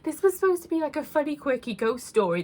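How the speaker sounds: teary laughing, pitch pushed well up; laughing through real tears at herself; embarrassed crying-laugh about the video's turn; still emotional. Real, not acted. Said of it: "I'm so emotional even still".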